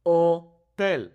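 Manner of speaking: Hotel split into oh, TEL; loud Spanish "Hotel", no h, syllables apart; silent H. Shown as a demonstration. Teacher shows the silent H, Hotel as oh, TEL. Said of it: "the H is silent here".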